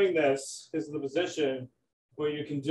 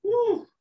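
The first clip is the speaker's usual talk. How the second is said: high whoop of relief; excited whoop. Real, not acted. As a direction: let out a big woo. Hard workout interval ends.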